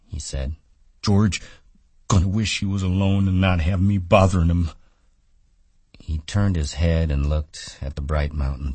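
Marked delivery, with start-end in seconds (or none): 1.0-4.7 put-on raised, simple childlike voice, a bit louder; sulky simple giant fretting; Lennie, childlike man. A narrator's reading.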